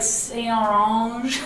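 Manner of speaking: "Saint Orange", drawn out slowly like a misheard name; mispronounced name. Retold or imitated. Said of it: "I've gotten, like".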